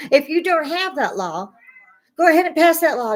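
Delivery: high heated rant at officials; mad ranting. Real, not acted. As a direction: let the anger build and push. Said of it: "I'm mad at every other state".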